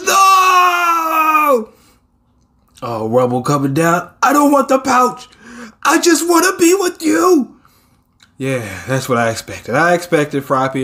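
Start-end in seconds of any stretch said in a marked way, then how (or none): 0.0-1.7 put-on high, loud, tearful shout of a child; crying shout. Child calling out to a parent in the rubble, crying shout. 4.2-7.6 put-on pleading child. Child begging the hero to stay with him, high pleading shout.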